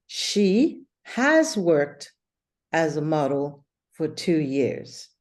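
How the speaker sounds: slow, deliberate example words; slow model phrase. Shown as a demonstration. Teacher modelling the tense for her student, slowly. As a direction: draw the phrase out for the learner. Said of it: "We're saying".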